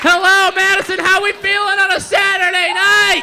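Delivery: high, loud shout to the audience; rousing crowd greeting. Real, not acted. Comedian opening a Saturday show, rousing shout. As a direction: hype up the room with a big hello.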